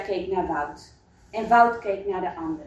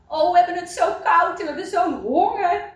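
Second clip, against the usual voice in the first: high-pitched, mildly tearful plaint; sobbing mother; 8 semitones higher, 4 dB louder than usual. A narrator's reading. Cold, hungry mother pleading with the villagers, mock sobbing. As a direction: plead as a cold, hungry mother, acted rather than really crying. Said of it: "snikte de moeder".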